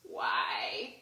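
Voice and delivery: low, whispered half-cry; whimpered why. Real, not acted. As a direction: whisper it, close to tears. Despairing at another spill.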